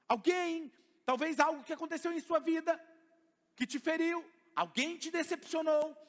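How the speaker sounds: high, loud pulpit voice; fiery preaching. Acted. Preacher rousing the congregation, loud and high. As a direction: drive the point home to the church.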